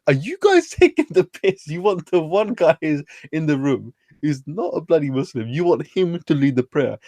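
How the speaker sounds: high, disbelieving cry with a laugh in it; incredulous outburst. Retold or imitated. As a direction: pitch it up in total disbelief that they mean it. Incredulous retort to family.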